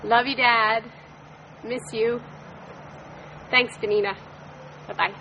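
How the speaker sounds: usual speaking voice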